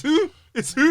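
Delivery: high, loud disbelieving question; incredulous reaction. Retold or imitated. Retelling the reaction to Cena's return, loudly incredulous. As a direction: shout it up high, as if you can't believe it. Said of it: "man was like".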